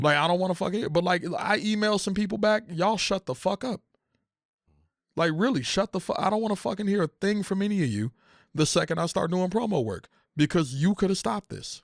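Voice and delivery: high, heated rant; angry. Real, not acted. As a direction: rant at them, fed up. Venting furiously at non-paying fans.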